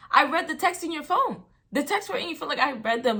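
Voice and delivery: heated, high-pitched accusation in a retold argument; confronting cheater. Girlfriend confronts cheating boyfriend with proof from texts, heated. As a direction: throw the accusation out high and loud.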